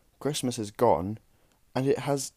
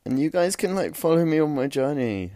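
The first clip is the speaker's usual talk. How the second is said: much higher and louder gushing influencer parody; mocking influencer; 7 semitones higher, 7 dB louder than usual. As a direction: send up a cheesy vlogger pitch. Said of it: "I hate when people say stuff like that".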